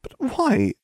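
very high, upset little question; mildly tearful girl's voice; sniffled. A narrator's reading. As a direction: ask plaintively, near tears. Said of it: "Hermione sniffled".

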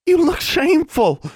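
very high, loud sputtering sister; sputtering outrage. A narrator's reading. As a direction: sputter it out scandalized. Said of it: "Constance sputtered".

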